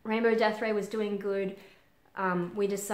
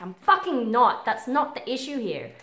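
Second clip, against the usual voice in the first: retold indignant outburst, louder and higher; exasperated retort; 4 semitones higher, 9 dB louder than usual. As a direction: push back forcefully, re-enacting the argument. Retorting to psychologist in retold quote.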